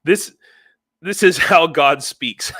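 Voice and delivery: loud, slow mock Australian proclamation; attempted Australian accent. Host reading Aussie viewer's joke, loud attempted Australian accent. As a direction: declare it loudly in a put-on Australian accent. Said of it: "I can't do it right now".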